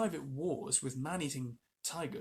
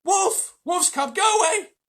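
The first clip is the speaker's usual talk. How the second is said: high, loud furious shout; priest shouting; 14 dB louder, slower than usual. A narrator's reading. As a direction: drive him off with a yell. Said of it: "shouted the priest".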